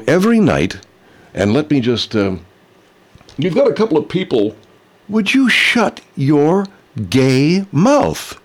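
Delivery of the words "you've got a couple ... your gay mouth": put-on mocking voice. Mock voice snapping at Jerry.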